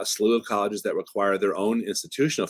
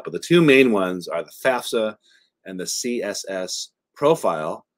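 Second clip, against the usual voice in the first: ordinary talk, nothing marked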